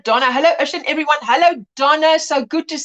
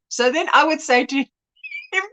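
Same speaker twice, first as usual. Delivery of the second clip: ordinary talk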